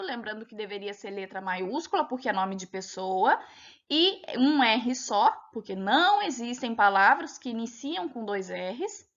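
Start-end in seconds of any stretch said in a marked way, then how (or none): none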